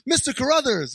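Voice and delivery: high, loud wailing name; mock alarmed call. Acting out running into the neighbor, mock alarmed cry.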